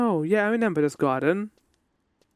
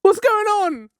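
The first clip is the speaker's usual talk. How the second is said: high, loud frantic character voice; high panicked old king; 12 semitones higher, 5 dB louder than usual. Acted. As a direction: panic in a squeaky put-on voice. Voicing senile king panicking.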